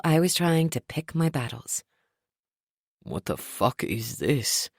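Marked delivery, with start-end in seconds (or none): 3.0-4.7 low, slow irritated snap; snapping. A narrator's reading. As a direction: snap it out in disgust. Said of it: "Trent snapped".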